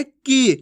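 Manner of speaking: की held on a long "ee"; drawn-out vowel. Shown as a demonstration. Teacher repeating long ee for learners, drawn out. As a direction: stretch out the vowel of की. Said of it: "खींच के करेंगे".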